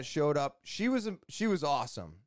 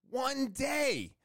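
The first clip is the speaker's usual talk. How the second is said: "One day." jumps much higher, amazed; excited echo; 10 semitones higher than usual. Real, not acted. Telling viewers the job takes only a day, excited and amazed.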